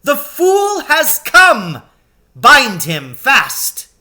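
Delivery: high, loud, slow witch cackle-cry; gloating witch. A narrator's reading. Witch calling to her hags to bind the lion, loud and slow. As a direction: crow over the captured lion, drawn out. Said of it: "she cried".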